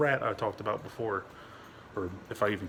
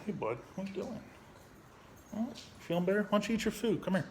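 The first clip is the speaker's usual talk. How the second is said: high, soft voice for a dog; coaxing pet talk; 9 semitones higher, 3 dB quieter, faster than usual. Real, not acted. Asking the dog about food, high gentle coaxing. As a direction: sweetly coax a dog to eat.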